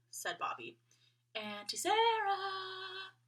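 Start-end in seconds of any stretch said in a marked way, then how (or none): 1.3-3.1 long drawn-out high call; stretched, searching call. A narrator's reading. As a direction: call her name across the dark house. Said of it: "Annabel and Bobby called".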